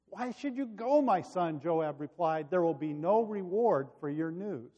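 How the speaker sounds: lively, expressive Bible narration; storyteller at the lectern; scripture story reading. Acted. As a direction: read the story aloud with animated quotes. Reads the Bible story aloud.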